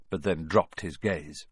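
usual speaking voice

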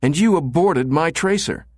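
high, sharp woman's retort; snapped. A narrator's reading. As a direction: fling the accusation back at him. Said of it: "she snapped".

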